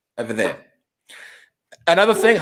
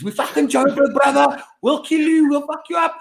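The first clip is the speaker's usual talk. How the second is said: much higher, hammed-up Joburg bar swagger; mock tough-guy bravado; 9 semitones higher than usual. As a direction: puff up like a rowdy local in a bar.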